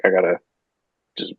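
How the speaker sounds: low-pitched, offhand retold quote; nonchalant. Injured surfer saying he needs rest, retold nonchalantly. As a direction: shrug it off in a low voice. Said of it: "Very nonchalant about it".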